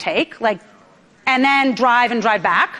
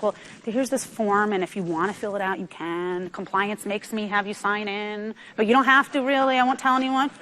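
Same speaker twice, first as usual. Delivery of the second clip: meek, softer, apologetic mumble; 3 semitones lower, 7 dB quieter than usual. Retold or imitated. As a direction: hand over the form as if sorry to ask. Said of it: "sounding like you're apologizing".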